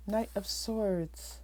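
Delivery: usual speaking voice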